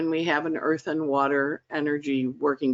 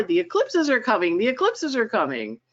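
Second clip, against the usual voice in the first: higher, ringing alarm call; herald's cry; 8 semitones higher than usual. Acted. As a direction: announce it like a warning rider.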